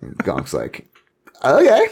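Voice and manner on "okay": high, loud eager okay; excited squeak. Acted. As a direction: pop it out like a thrilled kid. Gonk eagerly accepts the jam.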